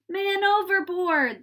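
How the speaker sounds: high, loud alarm call; sailors crying out. A narrator's reading. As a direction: shout the alarm across the deck. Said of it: "the sailors cried".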